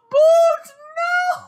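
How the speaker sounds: very high, loud squealed wail; goofy upset. Retold or imitated. Viewer mourning Boot, goofy high-pitched wail. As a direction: shriek it in goofy, hammed-up grief. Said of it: "upset in, like, a very goofy way".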